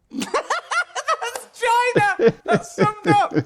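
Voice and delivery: high, slow laughing cry; delighted laugh at the summary; laughing shriek. Real, not acted. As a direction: squeal it through laughter.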